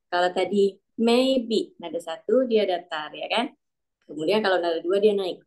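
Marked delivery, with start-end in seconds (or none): none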